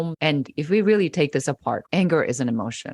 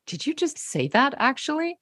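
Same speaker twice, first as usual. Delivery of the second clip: much higher, incredulous, half-whispered; mock disbelief; 10 semitones higher than usual. Giving a man a look after a stupid remark, incredulous. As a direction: stare them down in disbelief. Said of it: "you give them this look of just".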